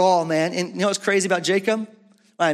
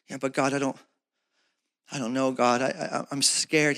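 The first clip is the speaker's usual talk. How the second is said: scared; 5 semitones lower, 5 dB quieter than usual. Acted. Scared believer talks to God.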